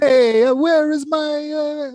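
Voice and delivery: high, loud Mario-style Italian voice; Italian accent. Retold or imitated. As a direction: do a cartoon Italian plumber. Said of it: "that's the Italian accent he's going with".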